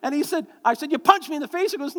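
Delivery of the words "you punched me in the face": high, loud indignant accusation; mock accusing. Father accusing his son of punching him, mock indignant.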